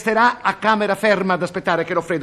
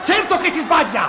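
higher, indignant retort; angry retort; 8 semitones higher than usual. Acted. Director angrily contradicting the cameraman. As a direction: shut him down hard.

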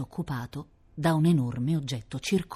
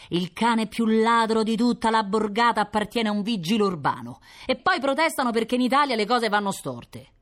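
grumbling butcher; 8 semitones higher, 5 dB louder than usual. Acted.

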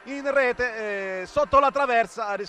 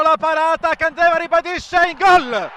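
higher, louder goal cry; goal shout; 4 semitones higher, 6 dB louder than usual. Real, not acted. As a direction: yell the rebound goal. Goal call in live commentary.

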